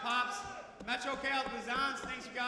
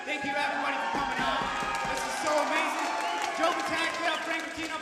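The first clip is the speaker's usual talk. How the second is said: loud, higher, thrilled thanks; excited shout; 5 semitones higher, 8 dB louder than usual. Real, not acted. Champion to the crowd after winning, loud thrilled shout. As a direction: call out over the roaring crowd.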